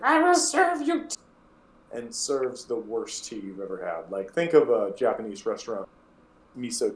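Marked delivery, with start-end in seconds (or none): 0.0-1.2 put-on much higher, loud, strange old woman cry; terrible hag voice. Gnarled mother calling to her returning kids, high terrible voice. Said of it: "their voices are terrible".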